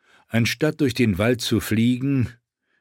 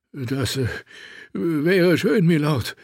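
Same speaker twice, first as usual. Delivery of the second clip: high, hesitant voice of a shivering guard; 6 semitones higher, slower than usual. A narrator's reading. Freezing guard answering his lord, high shivering-guard voice. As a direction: answer haltingly in a higher, thinner voice. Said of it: "hielten zitternd Wache".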